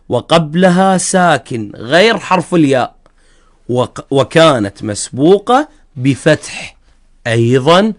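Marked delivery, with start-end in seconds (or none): none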